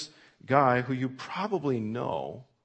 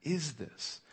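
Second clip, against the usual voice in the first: amazed question; 6 semitones higher, 4 dB quieter, much slower than usual. Acted. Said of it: "they start asking".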